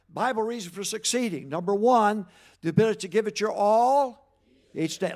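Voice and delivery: loud, high call to the crowd; rallying recitation. Real, not acted. Preacher drilling his congregation in a loud, rising call. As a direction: lead the crowd through the list with force.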